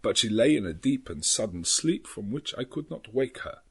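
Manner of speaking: a lightly voiced old Dutch professor, grave and weary; Van Helsing voice. A narrator's reading. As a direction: read it as the old doctor, measured and tired. Van Helsing's memorandum read in voice.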